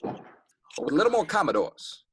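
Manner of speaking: [name]. Acted. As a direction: lift the pitch into a soul singer's speaking voice. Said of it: "[name]'s just right here".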